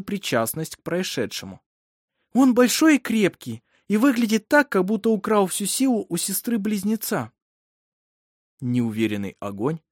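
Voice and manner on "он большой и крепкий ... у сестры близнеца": high, raised, glowing report; proud excitement. A narrator's reading. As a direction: show off the newborn to his mother. Midwife proudly describes the newborn boy.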